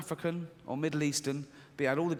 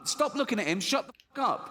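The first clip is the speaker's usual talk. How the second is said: fearful inner voice; 8 semitones higher than usual. Retold or imitated. Comedian voicing his fearful side, high and rising panic. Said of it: "This one goes".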